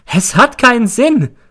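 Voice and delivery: high, loud hysterical shriek. A narrator's reading. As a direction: shriek it out in a frenzy. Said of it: "Dann kreischt sie". (gellend kreischen)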